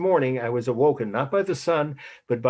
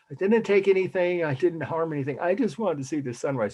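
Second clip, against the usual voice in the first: pleading protest; 7 semitones higher than usual. Retold or imitated. Retold pleading to an angry guard.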